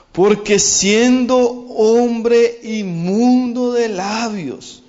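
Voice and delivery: slow, weighty reading of scripture; solemn scripture reading. Acted. Preacher reading Isaiah's cry to the church, slow and solemn. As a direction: draw out each phrase of the lament, slowly. Reads Isaiah's lament slowly.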